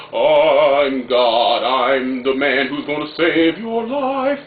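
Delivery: grandiose mock orator, slow and booming; mock Obama. Vlogger mocking Obama's lofty speeches for viewers, hammed-up grand voice. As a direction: ham it up like a self-declared messiah. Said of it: "foreign oratory that Obama comes up with".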